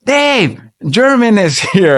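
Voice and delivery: hammed-up excited announcement with a laugh; excited call. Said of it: "She's like".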